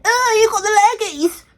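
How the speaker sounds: high, singsong playground tease; schoolkid taunt. Retold or imitated. To a classmate at primary school, high singsong taunt. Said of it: "as we used to call it in primary school".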